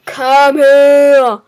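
hungry chase shout; loud call. Real, not acted. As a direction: shout after the target. Chasing a player in game.

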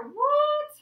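sudden high yelp of surprise; mock surprise. Teacher fakes shock at her own worksheet, high and loud.

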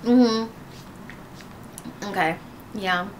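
chewing while talking; speaking between bites; mouth full. Real, not acted. Seen 0: Left woman holds bitten cookie, mouth working as if chewing while talking.

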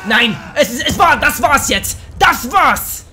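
loud, high cry of defeat; shouted despair at losing; frustrated shout. Real, not acted. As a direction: yell it out, game over. Dies again, shouting.

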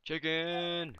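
high shout. Real, not acted. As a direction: call it out loud and high. Calling out at a chicken.

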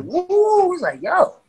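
long high-pitched whoop; imitating a wowed listener's whoop; retold thrill. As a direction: let out a high held "woo".

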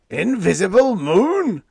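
high, loud, slow disbelief; captain and radioman echoing together; shocked echo. A narrator's reading. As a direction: echo it stunned. Two men echo in shock.

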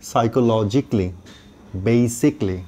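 normal talking voice

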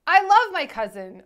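quoting Leto. Retold or imitated. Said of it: "should I say it in a bad Italian accent".

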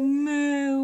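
loud drawn-out moo; cow moo. Acted. Parent moos for toddler.

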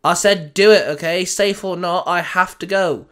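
loud, sharp insistence; snapped. Acted. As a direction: snap the order back at him. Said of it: "Riri snapped".

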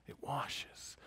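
washes breathed in a soft whisper. Shown as a demonstration. Repeating washes, then whispering.

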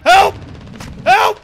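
high, loud hammed-up yelp; mock cry for help. Gamer pinned down jokingly yelps for help, high squeal. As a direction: squeal for help like a cartoon victim.

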